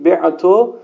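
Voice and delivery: بعتو with a heavy, throaty ع; thick ein. Shown as a demonstration. Teacher shows students a thick ع in بعتو. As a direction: lean hard on the ع of بعتو. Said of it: "عینش هم غلیظ تلفظ کنید".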